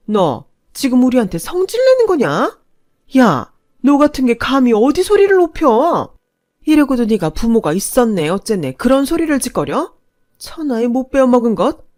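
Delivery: high, loud raging insults; furious father screaming insults at the young woman; furious shout. Acted. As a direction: scream contempt at her.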